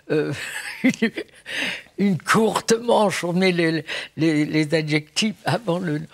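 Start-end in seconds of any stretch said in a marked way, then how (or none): 0.8-3.2 "courte" before "manche", drawn out slowly and pitched up; northern word order. Shown as a demonstration. Singer giving a second northern example, slowly and higher. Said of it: "Dans le Nord, on dit".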